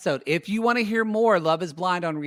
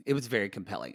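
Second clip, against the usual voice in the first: softer, lower repeat; quiet; 6 semitones lower, 7 dB quieter, faster than usual. Shown as a demonstration. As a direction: let it drop away quietly. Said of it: "I agree".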